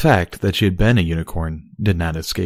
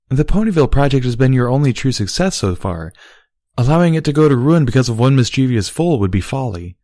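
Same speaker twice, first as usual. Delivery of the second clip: Luna explaining; 7 semitones higher, 4 dB louder than usual. A narrator's reading. Luna voiced in story.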